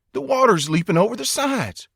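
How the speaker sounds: high, wide-eyed cry of amazement; astonished. A narrator's reading. A man in the car pointing out leaping water, astonished. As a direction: call out the wonder you see.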